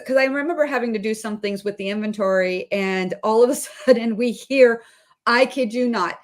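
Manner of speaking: usual speaking voice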